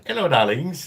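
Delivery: put-on high, loud sing-song hello to old ladies; a cheerful mock-charming greeting; cheeky greeting. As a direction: call out playfully as you ride past.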